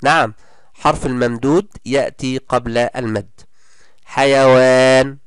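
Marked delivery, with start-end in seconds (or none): 4.0-5.2 حيوان read slowly and louder, the long alif stretched; slow drawn-out vowel. Shown as a demonstration. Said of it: "الواو حرف ممدود والمد ألف". (مدّ طويل بطيء بصوت أعلى)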